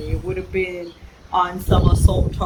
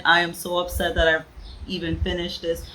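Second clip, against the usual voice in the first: normal talking voice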